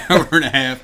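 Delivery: real laugh breaking into the words. Real, not acted. Co-host teasing Bub about his editing time, laughing.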